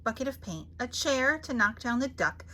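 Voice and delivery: ordinary delivery, nothing marked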